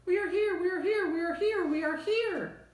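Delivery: very high squeaky chorus of tiny people; tiny voices crying out. A narrator's reading. Tiny Whos crying out in fear, very high and squeaky. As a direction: squeak it out like a crowd of specks, scared. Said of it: "They cried out in fear".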